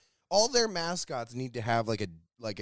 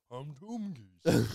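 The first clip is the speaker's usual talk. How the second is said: slow put-on voice; much slower than usual. Mocking a movie voice.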